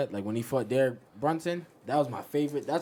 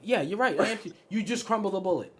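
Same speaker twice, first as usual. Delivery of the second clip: higher, hasty comic surrender; mock frightened agreement; 7 semitones higher, 3 dB louder than usual. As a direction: back down comically before a giant. Mock giving in to bulletproof giant.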